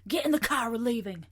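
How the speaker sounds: loud, high order to go; urgent calling out. Acted. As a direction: call it out like rounding everyone up. Imagined order to leave, called out.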